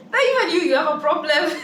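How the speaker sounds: high, laughing through the words; amused jab at gullible viewers. Real, not acted. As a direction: laugh while delivering the jab. Laughing at the believers.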